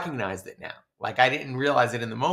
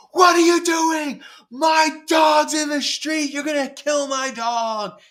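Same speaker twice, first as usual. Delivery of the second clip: high, drawn-out full scream; top-of-lungs scream; 7 dB louder, slower than usual. Retold or imitated. Dog owner yelling at a driver about to hit the dog, screaming. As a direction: shriek it in outraged panic, stretching the words. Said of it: "I scream at the top of my lungs".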